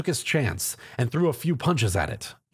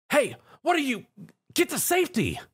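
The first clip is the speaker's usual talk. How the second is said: calling out a warning; 12 semitones higher than usual. Acted. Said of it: "Zio announced".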